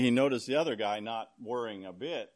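normal talking voice

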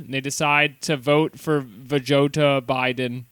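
ordinary talk, nothing marked

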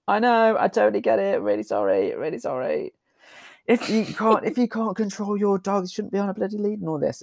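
high, rushed mock apology; apologetic self. Speaker retells apologizing to the farmer, high and hurried. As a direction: gush sorry at the farmer. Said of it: "And I'm like".

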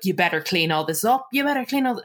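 ordinary talk

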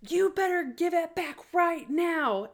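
high, slow mock-angry order; angry teacher. Counselor scolding the jock in the hallway, mock angry and high. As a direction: play the furious counselor, drawn out. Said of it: "she got super mad and was like".

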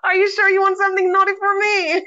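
high, teasing question; playful teasing. Acted. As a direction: tease her playfully. Host teasing guest.

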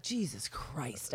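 exasperated exclamation. Retold or imitated. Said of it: "I'll be like".

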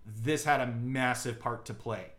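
ordinary talk, nothing marked

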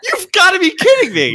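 high, loud disbelief; you can't believe the call; incredulous outburst. Retold or imitated. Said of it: "I was like".

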